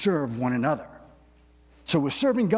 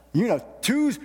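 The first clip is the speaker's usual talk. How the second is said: higher excited; 9 semitones higher than usual. Real, not acted. Crowd reacting to terrible twos.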